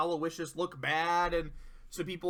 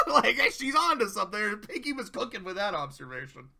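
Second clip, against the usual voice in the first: louder, still laughing as you speak; laughing through words; 3 semitones higher, 5 dB louder than usual. Real, not acted. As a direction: talk while the laugh fades out. Still laughing after joke.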